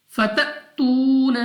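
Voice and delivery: फततून with a sharp catch on the hamza, said slowly; hamza sakin with a jolt. Shown as a demonstration. Teacher demonstrates the hamza sakin jolt, slow and deliberate. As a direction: jolt the stop in फततून, then release. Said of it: "जैसा कि फत".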